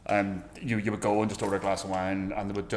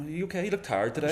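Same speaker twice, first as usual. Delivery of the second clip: put-on caring shop girl; 7 semitones higher than usual. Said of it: "the girl going".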